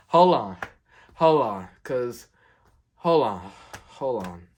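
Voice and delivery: loud, exasperated hold on, fading down; angry hold on. Real, not acted. Telling viewers about a rude customer, loud indignant hold on. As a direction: stop the story, loud and indignant, then settle. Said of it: "I'm, like, angry".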